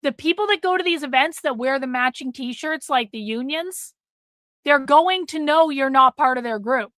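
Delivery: ordinary delivery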